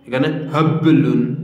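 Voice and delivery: হাবলুন with the ব bounced too hard, as a vowel হাবেলুন; wrong qalqala. Shown as a demonstration. Teacher shows students the wrong way, slowly. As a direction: overdo the ব in হাবলুন as a mistake. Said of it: "এভাবে হবে না".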